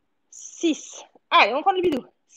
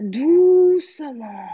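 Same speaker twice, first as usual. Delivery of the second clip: drawn-out soothing cue; 6 dB louder, much slower than usual. Shown as a demonstration. Coach slows the movement.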